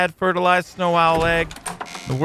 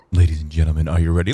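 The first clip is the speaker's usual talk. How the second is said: much lower, booming ringmaster build-up; deep announcer voice; 14 semitones lower, faster than usual. Acted. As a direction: announce it like a ringmaster revving up the crowd. Hypes the dive like an announcer.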